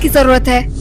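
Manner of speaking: usual speaking voice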